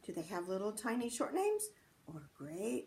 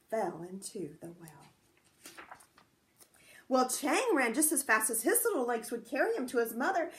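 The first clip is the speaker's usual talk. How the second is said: plain reading voice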